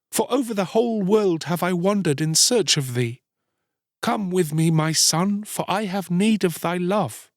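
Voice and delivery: high-pitched, imploring woman; pleading beggar mother. A narrator's reading. Mother begging her son to come home, pleading. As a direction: beg earnestly, voice lifted throughout.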